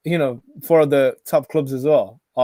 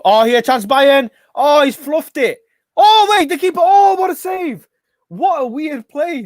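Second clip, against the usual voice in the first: excited; 9 semitones higher, 5 dB louder than usual. Real, not acted. Live reaction to a chance.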